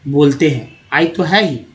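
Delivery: usual speaking voice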